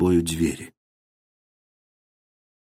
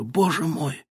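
defiance breaking out of despair; desperate outburst; 11 semitones higher than usual. A narrator's reading. Daughter threatens her father with a protector, desperate outburst.

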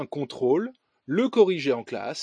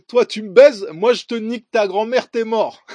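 put-on much louder, higher French banlieue accent; French suburb street slang; 5 semitones higher, 11 dB louder than usual. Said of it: "Donc, affaire avec l'accent".

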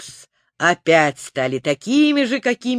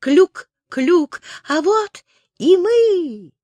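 chirpy alien voice; 9 semitones higher, slower than usual. Acted. Green astronaut arrives.

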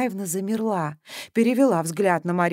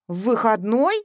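surprised; 4 semitones higher, 4 dB louder than usual. A narrator's reading. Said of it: "удивилась".

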